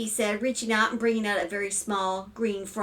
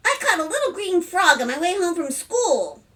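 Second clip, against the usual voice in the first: put-on high, louder child voice; eager little boy; 9 semitones higher, 5 dB louder than usual. A narrator's reading. Young son interrupts about his frog, eager child. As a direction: pipe up like a little kid.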